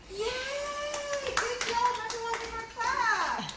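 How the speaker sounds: high, loud cheer of praise; excited cheer. Real, not acted. Teacher praises child, asks for clap.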